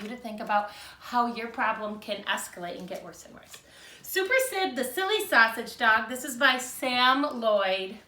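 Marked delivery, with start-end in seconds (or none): 4.0-8.0 put-on lively read-aloud for young children; storybook reading. A narrator's reading. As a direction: read it like a picture book to second graders. Teacher reads picture book aloud.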